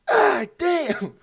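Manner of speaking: high, loud mock scream; imitated pained yell. Friend stepped on in the dark, imitated pained yell. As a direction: yelp out as if trodden on.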